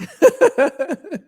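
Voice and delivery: light high laugh. Real, not acted. Laughing at her own risk-taking, light and high. Laughing about risky choices.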